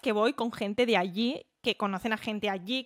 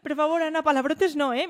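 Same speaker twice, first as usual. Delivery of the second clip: higher, louder mother scolding in Valencian; 6 semitones higher, 5 dB louder than usual. Retold or imitated. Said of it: "me parla en valencià".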